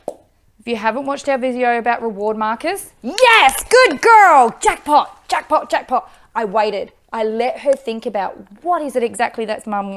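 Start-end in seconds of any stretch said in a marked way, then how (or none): 3.0-6.1 excited praise. Real, not acted. Praising dog after long wait.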